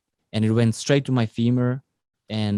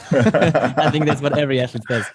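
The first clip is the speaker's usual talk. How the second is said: laughing; 3 semitones higher than usual. Real, not acted. Jokes about feeling old.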